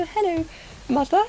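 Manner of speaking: high-pitched, mock-posh greeting; hammed-up posh accent for mother; nice posh accent. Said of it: "And speak really nicely at home".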